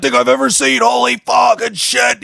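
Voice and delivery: high-pitched hyped-up raving; imitating losing his mind. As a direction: act out a friend going wild over a show. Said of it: "He was just losing his mind".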